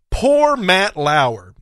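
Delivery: drawn-out mock sympathy, very slow; sarcastic. Real, not acted. Radio host mocking a TV moderator, sarcastic. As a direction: stretch the words out in sarcastic pity. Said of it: "I say that sarcastically".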